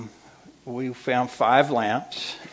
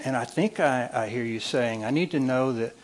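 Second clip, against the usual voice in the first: ordinary talk, nothing marked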